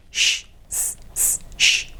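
unvoiced "Sh, s, s, sh", each sound separate; isolated sh and s. Shown as a demonstration. Teacher breaking a phrase into its sounds, isolated sh and s. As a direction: hold "sh" and "s" as pure breath sounds. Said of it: "nos quedan estos sonidos".

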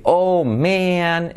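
MAN drawn out as "meh-an"; full "ea" vowel. Shown as a demonstration. Teacher showing how man sounds, drawn-out. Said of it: "suena ea".

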